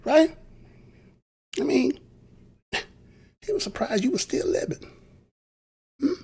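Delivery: ordinary talk, nothing marked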